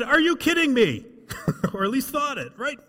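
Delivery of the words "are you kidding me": shepherds' disbelief. Pretend. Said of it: "the shepherds probably said".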